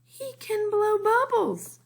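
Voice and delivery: high, bright-eyed proud brag; proud boast. A narrator's reading. Lily boasting about her baby brother, proud and high. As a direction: show him off proudly.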